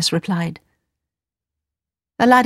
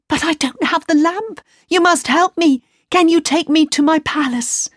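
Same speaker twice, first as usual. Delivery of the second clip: pleading; 9 semitones higher than usual. Acted. Aladdin begs the genie.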